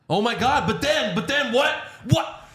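high, rising hammed-up alarm; mock panic. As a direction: fake a shocked high-pitched freak-out. Mock panic recapping a wrestling brawl.

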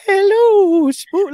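very high, loud spooky squeal; spooky Halloween voice. Pretend. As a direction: squeal it like a cartoon ghost. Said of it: "a Halloween voice".

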